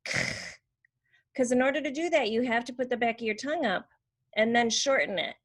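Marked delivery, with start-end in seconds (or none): 0.0-0.2 drawn-out scraping voiceless k; throat-clearing sound. Shown as a demonstration. Therapist showing kids a trick, throat-clearing k. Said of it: "make a throat clearing sound".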